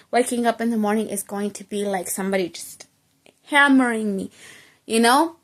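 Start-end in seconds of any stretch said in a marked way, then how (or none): none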